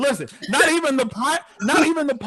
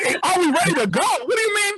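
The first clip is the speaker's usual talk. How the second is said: higher, rising indignant complaint; indignant rant; 4 semitones higher than usual. Retold or imitated. Paying passenger scolds the casual pilot, rising indignation. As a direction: demand respect, getting more outraged.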